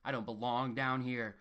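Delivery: ordinary talk, nothing marked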